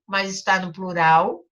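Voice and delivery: ordinary talk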